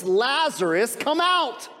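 calling a dead man out of the tomb, loud and high; Jesus to Lazarus in the tomb, a loud commanding call. A narrator's reading. Jesus calls Lazarus from the grave, loud. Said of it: "called out in a loud voice".

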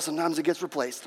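ordinary talk

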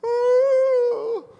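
long, high "ooh" of complaint; mock moan. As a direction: moan like a whining crowd. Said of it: "Moaning and complaining".

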